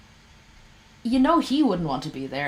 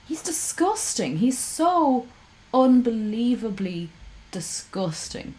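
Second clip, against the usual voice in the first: disgusted; 5 semitones higher, slower than usual. Real, not acted. Disgust at Lorne.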